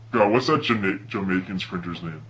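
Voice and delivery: ordinary talk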